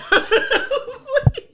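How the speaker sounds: a very high, loud burst of laughter; squealing laugh; high shriek of laughter. Real, not acted. Cracks up at the story.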